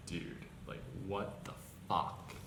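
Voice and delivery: hushed, breathy what the fuck in real shock; whispered disbelief. Real, not acted. Baffled by the racism claim, whispering in disbelief. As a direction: say "what the fuck" under your breath, stunned.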